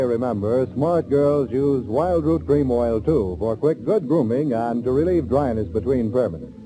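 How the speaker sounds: ordinary talk, nothing marked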